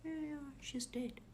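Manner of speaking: very soft, high, offhand confirmation; calm. Retold or imitated. Calm daughter confirming it to startled robbers, very softly. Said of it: "My daughter is calm".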